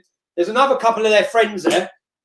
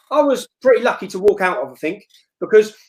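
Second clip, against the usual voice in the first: ordinary talk, nothing marked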